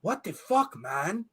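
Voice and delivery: mock German accent starting high, then dropping; attempted German accent. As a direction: imitate a German buddy, badly and high-pitched. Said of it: "I can't talk like a German person".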